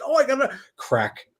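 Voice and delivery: high, bright mock cheer over a crack; mock cheery reaction. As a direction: play it falsely upbeat about the damage. Mock reaction to broken figure.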